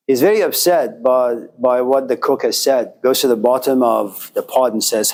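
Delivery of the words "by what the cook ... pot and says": ordinary talk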